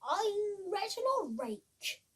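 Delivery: slow, pompous introduction; a hint of a vain dandy; lightly voiced show-off scarecrow; smug scarecrow. Smug new scarecrow introduces himself.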